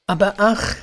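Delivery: high, loud cry of woe. A narrator's reading. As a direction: burst out in dismay. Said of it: "rief sie wieder".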